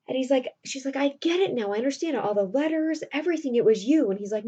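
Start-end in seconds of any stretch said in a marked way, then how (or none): 1.0-4.1 higher, emphatic retold revelation; dawning realization. Roxanne realizes Cyrano wrote all the letters, emphatic. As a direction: act out piecing it together, pitch lifted.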